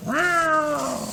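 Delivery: high eerie cat wail; a long spooky cat meow; cat cry. Acted.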